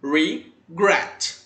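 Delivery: regret split in two, re-GRET; stress on last syllable. Shown as a demonstration. Teacher showing the stressed syllable, regret with GRET strong. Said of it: "tem a sua sílaba tônica na última sílaba".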